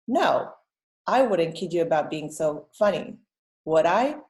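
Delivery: teacher reads it, stopping at both commas; pauses at commas. Shown as a demonstration. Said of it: "Can you hear where they go?".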